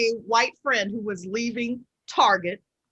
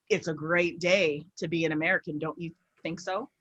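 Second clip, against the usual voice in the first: imitated older man; 7 semitones lower, 7 dB quieter than usual. Voices the employee repeating himself.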